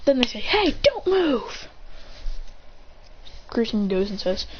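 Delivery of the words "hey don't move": high, slow halt order; acted command. Ninjas ordering a rival to halt at the top, high drawn-out call.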